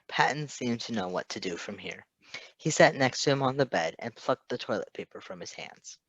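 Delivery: ordinary delivery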